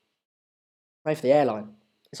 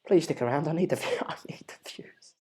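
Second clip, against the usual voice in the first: whispered plea with a laugh; begging viewers to stay, whispered; 4 semitones lower, 14 dB quieter than usual. Real, not acted. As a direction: breathe it, half joking.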